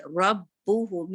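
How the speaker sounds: रब्बुहू with a heavy, full ra; रब्बुहू drawn out, ra made heavy. Shown as a demonstration. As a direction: recite रब्बुहू slowly, ra heavy. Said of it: "रॉ heavy".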